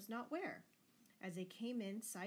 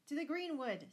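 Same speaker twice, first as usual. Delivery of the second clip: ordinary delivery, nothing marked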